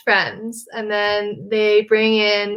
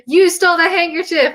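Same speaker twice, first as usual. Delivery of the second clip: higher-pitched mock yell; mock yelled accusation; 7 semitones higher, 3 dB louder than usual. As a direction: accuse them in a half-hearted yell. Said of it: "they're yelling at each other".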